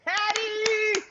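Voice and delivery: high, thrilled calls of thanks; excited cheering. Real, not acted. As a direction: cheer the name out. Cheering a viewer's llama award.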